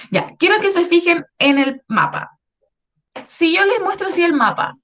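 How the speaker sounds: usual speaking voice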